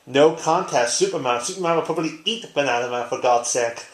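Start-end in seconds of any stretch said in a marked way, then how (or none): none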